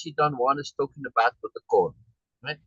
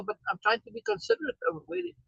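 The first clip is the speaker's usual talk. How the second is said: ordinary talk, nothing marked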